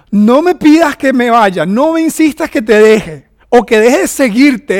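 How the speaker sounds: loud, slow, proclaiming the vow; loud mock declaration. Preacher voicing his wife's vow to the congregation, loud and slow. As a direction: belt out the wife's promise.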